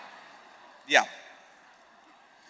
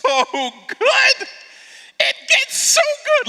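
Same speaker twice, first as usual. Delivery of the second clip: choked up; slower than usual. Real, not acted. Preacher overcome with emotion.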